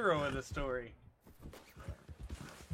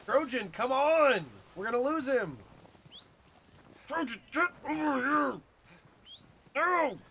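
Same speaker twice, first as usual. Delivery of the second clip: raised, pleading calls to the horse; urgent calling; 7 semitones higher, 3 dB louder, slower than usual. Real, not acted. Rider urging his horse during the chase, high urgent calls. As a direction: urge the horse on, voice pitched high.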